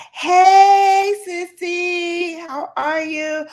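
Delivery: high, drawn-out calling hello; excited greeting. Real, not acted. Host greets a friend joining the live, excited. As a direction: call out gleefully, long loud "hey".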